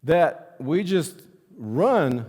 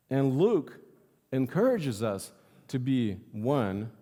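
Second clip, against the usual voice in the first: ordinary talk, nothing marked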